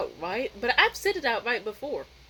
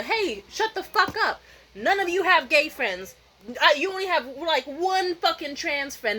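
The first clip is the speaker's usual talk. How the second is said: higher, emphatic telling-off; imagined angry outburst; 5 semitones higher, 3 dB louder than usual. Pretend. Telling off a hostile audience, angry outburst. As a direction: let the held-back anger out. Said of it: "could have let out the anger".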